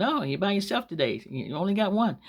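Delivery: high, mock-surprised jibe; sarcastic. Lady to a mother out shopping, sarcastic. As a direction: feign surprise to poke fun. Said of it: "She was being sarcastic".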